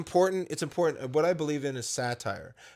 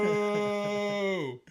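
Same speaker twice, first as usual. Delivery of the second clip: higher booing crowd noise; mock booing; 5 semitones higher than usual. Booing the bit as a fake crowd, mock boo. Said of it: "Jimmy Fallon".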